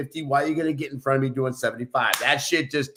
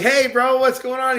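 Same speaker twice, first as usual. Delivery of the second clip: excited greeting; 12 semitones higher, 6 dB louder than usual. Retold or imitated. Greeting Jeff at the bar.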